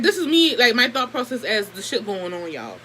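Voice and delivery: ordinary talk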